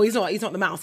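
usual speaking voice

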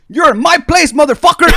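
high, very loud territorial yell; hammed-up furious shout; imitated irate kitchen guy. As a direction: roar it like the boss of the kitchen. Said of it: "The kitchen guy goes".

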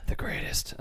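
soft whispered echo; whispered, well under talking level; hushed whisper. Acted. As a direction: whisper it back in mock reverence.

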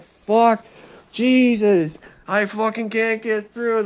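loud, high mock panic; retold panicked yell. Vlogger imitates himself trapped in smoke, loud mock panic.

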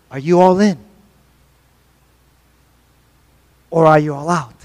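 a hint of a low, soft challenge; understated Jesus asking if you're all in; Jesus' challenge. Preacher voicing Jesus' challenge.

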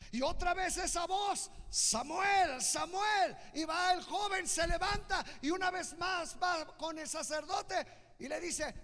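high, loud preacher's proclamation; full-force preaching. Real, not acted. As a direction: preach it out to the whole congregation at full voice.

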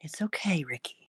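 whispered comfort; soothing whisper. Acted. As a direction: whisper it gently to calm him.

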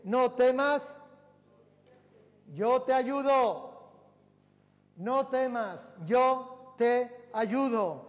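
slow, lofty, ringing scripture voice; stretched, majestic reading of the divine promise; solemn voice of God. Acted. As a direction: speak as God promising help, slowly. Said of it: "Dice la palabra del Señor".

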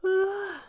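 high, airy acted yawn; sleepy character's yawn. A narrator's reading. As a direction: let out a sighing yawn in a high pitch. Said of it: "the Mock Turtle yawned".

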